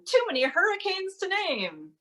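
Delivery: very high, mock-alarmed headline; news headline. Performer mimicking the news on hurricanes, high mock-alarmed voice. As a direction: parody the news in a high, wailing pitch. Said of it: "the news is always saying things like".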